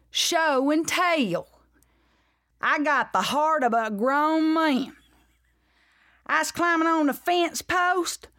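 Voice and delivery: slow, high, drawn-out drawl; bully girl. Shown as a demonstration. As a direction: draw every word out in a high Southern bully voice. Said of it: "well, that's how she talks".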